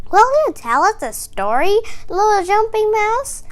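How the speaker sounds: high, quick eager little squirrel; excited squirrel voice. A narrator's reading. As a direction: beg for a story like a small excited squirrel. Squirrel asks for a story.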